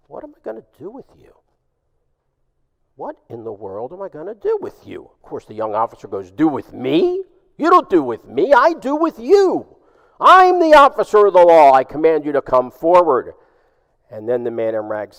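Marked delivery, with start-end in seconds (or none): 0.1-5.1 soft, hushed voice from the darkness; mysterious stranger. Acted. Man in rags speaking to the officer from darkness, hushed. 6.3-13.3 rising outrage, higher and louder, peaking on I do with you; indignant officer. Acted. Officer insisting on his authority over the man in rags, indignant and loud.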